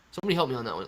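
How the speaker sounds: ordinary talk, nothing marked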